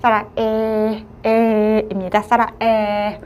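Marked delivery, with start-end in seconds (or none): 0.0-1.0 사라에 said slowly with lips spread in a smile; smiling vowel e. Shown as a demonstration. Teacher showing learners the vowel, lips spread in a smile. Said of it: "입술을 스마일 해주시고".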